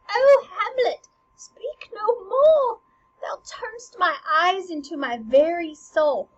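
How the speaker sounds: high, loud, pleading cry; upset queen. Retold or imitated. Queen begs her son Hamlet to stop, crying out. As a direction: beg him to stop, voice breaking.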